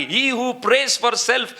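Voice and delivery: very slow, high, weighty English quote; slow solemn quotation, pitched high; quoting Billy Graham. Retold or imitated. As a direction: drag each word out like a solemn maxim. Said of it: "ಬಿಲ್ಲಿ ಗ್ರಾಹಂ ಹೇಳ್ತಾರೆ".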